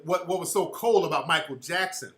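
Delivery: ordinary delivery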